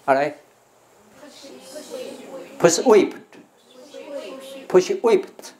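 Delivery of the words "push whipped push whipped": slow, high "Push whipped" with a strong "Push"; stress on PUSH. Shown as a demonstration. Teacher drilling "Push whipped" for repetition, stressed and slow. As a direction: model "Push whipped" word by word, "Push" punched. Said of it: "push再重一点啊".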